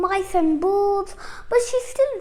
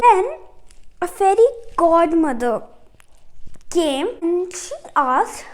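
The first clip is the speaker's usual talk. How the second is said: ordinary delivery, nothing marked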